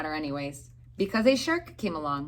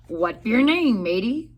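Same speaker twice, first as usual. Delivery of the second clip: pirate voice; 3 semitones higher, 6 dB louder than usual. A narrator's reading. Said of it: "the head pirate asked".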